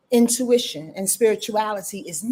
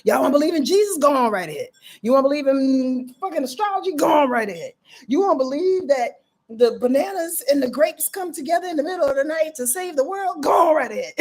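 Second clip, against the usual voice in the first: booming, much higher and louder, like a preacher on high; God voice; 5 semitones higher, 3 dB louder than usual. Acted. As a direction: speak as God proclaiming from above. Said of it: "This is my God voice".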